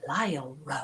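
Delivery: low, breathy narrator impression; imitating audiobook narrator. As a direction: linger low and softly on the words, like an overdramatic narrator. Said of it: "he pronounces, he talks like".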